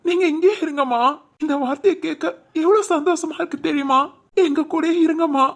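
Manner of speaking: son's delighted plea to his mother; joyful plea. Acted. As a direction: beg her to stay, bursting with happiness. Son begs mother to stay.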